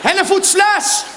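slow, high, loud holler; drawn-out carrying shout; calling a child's name. Acted. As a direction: stretch the name out as a parent's call. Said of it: "Hoe heet je kind?".

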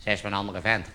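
very quiet, low nagging thought; little inner voice. Acted. Little voice at the back of his head, hushed inner voice. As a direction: murmur it like a doubt creeping in. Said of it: "zo'n stemmetje in je achterhoofd".

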